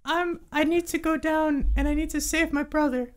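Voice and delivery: high little creature, pleading a touch; anxious kobold. Acted. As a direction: confess it in a squeaky kobold voice. Game master voices kobold.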